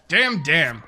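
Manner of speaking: loud double "Damn, damn"; quoted exclamation, hit twice; imitated catchphrase. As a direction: punch both words out, falling. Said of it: "No, it was damn".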